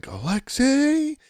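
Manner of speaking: very high, drawn-out announcer yell; Hawk Harrelson yell. Retold or imitated. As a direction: stretch the name out slowly like a TV call. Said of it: "Hawk is like".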